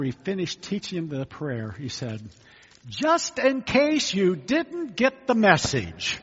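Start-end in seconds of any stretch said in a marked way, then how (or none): 2.9-6.2 slow, pointed, higher and louder; pointed paraphrase. Retold or imitated. Jesus drives the lesson home, slow and pointed. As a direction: spell it out slowly as a warning. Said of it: "he doesn't say it quite like that".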